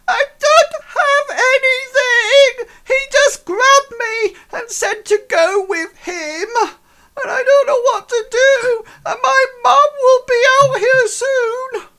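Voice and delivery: put-on high, loud sobbing child; crying urchin. Urchin pleading with the guards, high and crying loud. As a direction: plead through tears like a frightened boy. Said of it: "he goes".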